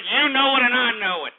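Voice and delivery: high, loud insistence; indignant. Real, not acted. Host ranting at chat room slackers, indignant.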